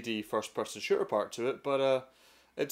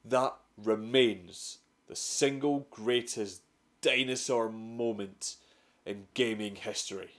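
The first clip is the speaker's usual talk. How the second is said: ordinary talk, nothing marked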